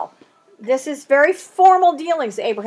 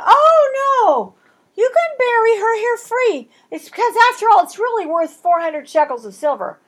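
high, louder fawning seller; hammed-up giveaway pitched up; mock generous Ephron; 9 semitones higher, 5 dB louder than usual. Ephron feigns free gift.

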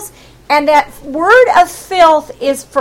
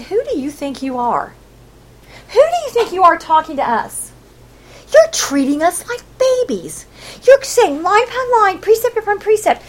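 indignant retort; 5 semitones higher than usual. Acted. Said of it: "making fun of Isaiah".

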